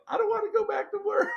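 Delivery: very high, whining plea; whiny complaint. Retold or imitated. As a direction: whine like a kid who won't go to school. Curled up in a fetal position.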